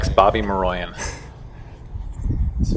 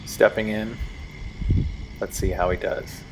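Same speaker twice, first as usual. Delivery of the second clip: hushed golf announcer voice; quiet TV golf commentary; golf commentator; 9 dB quieter than usual. Acted.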